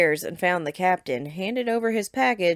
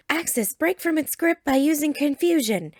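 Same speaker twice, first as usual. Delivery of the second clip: battle command; 9 semitones higher than usual. A narrator's reading. Trainer calling a battle command.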